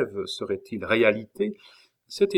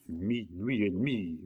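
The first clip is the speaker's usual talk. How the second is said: grumbled; 6 dB quieter than usual. A narrator's reading. Innkeeper hears the clock strike, grumbling. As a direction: grumble it softly to yourself. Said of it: "grommela le cabaretier".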